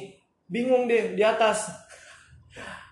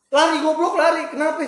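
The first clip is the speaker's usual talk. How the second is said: shouting; 11 semitones higher, 6 dB louder than usual. Retold or imitated. Panicked friend yelling to run.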